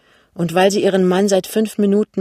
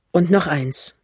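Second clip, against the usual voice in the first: ordinary delivery, nothing marked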